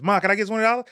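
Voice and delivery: mock childlike ask; kid asking mom. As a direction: beg like a little boy asking mom for cash.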